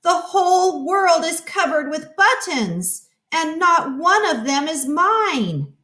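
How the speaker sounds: high, hammed-up storybook scream; toddler-style angry squeal; mock scream. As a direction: Toad screaming in a tantrum, pitched high.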